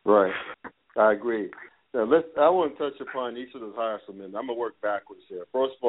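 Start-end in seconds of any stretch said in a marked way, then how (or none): none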